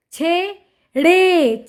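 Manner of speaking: छे and ड़े slow and separate; sounding out. Shown as a demonstration. Teacher reading to children, syllable by syllable. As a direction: stretch both halves: छे... ड़े. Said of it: "उच्चारण करके पढ़ लिया".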